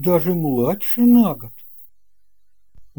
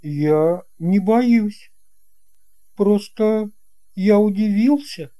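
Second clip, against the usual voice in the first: put-on boy's denial; 6 semitones higher than usual. A narrator's reading. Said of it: "Алёшка, reply to pilot".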